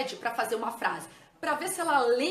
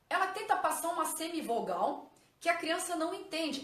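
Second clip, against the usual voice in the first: usual speaking voice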